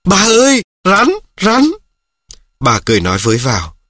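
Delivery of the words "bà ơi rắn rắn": much higher, louder frightened cry; a boy spotting a snake calls out in fright. Acted. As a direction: shout the alarm to grandma. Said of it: "Tôi kêu lên".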